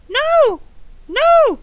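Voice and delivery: high parrot echo. Acted. Parrot echoing "you may not", high parrot voice. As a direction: squawk each word high, one by one.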